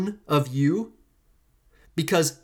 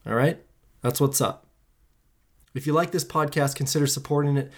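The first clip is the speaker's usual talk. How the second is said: ordinary talk, nothing marked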